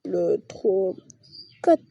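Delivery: ordinary delivery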